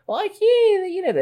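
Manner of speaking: high-pitched mock wail of protest; mock screaming. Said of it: "βγαίνουν ορισμένοι και ουρλιάζουν".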